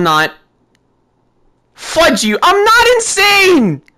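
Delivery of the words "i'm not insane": drawn-out higher pleading cry; desperate yell. Real, not acted. Player yelling at the game that he's not insane. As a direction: yell it like you're losing your mind.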